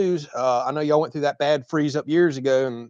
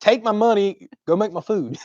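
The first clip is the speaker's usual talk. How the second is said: higher-pitched retold comeback; mock exasperation; 8 semitones higher than usual. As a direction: playfully tell off the giggling server. Retold comeback to mocking server.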